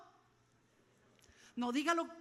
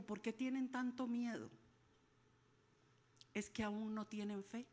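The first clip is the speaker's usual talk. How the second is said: gentle rebuke; 4 semitones lower, 5 dB quieter than usual. Retold or imitated. Jesus gently rebukes disciples.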